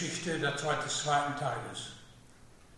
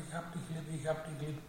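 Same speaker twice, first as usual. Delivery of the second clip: softly; 10 dB quieter, faster than usual. Acted. Peter answers the Lord.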